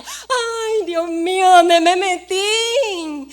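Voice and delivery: acted panic on arriving in a dangerous neighbourhood; frightened. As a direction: wail it as if you want to run home. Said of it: "Yo estaba temblando".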